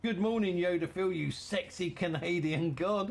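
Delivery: ordinary talk, nothing marked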